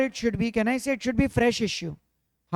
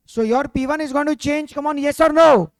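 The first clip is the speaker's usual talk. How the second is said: higher, louder pushing; loud demand; 5 semitones higher, 8 dB louder than usual. Real, not acted. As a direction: push the class hard, loudly. Teacher demands answer loudly.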